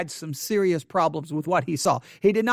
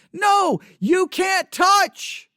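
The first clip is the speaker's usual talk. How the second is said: shout; 10 semitones higher, 4 dB louder, much slower than usual. Real, not acted. Preacher shouting a warning.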